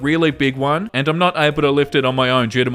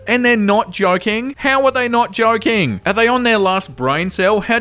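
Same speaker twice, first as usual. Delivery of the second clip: higher-pitched, astonished questioning; incredulous; 5 semitones higher than usual. Real, not acted. Host reacting to an absurd holiday complaint, incredulous. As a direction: raise the pitch in sheer disbelief.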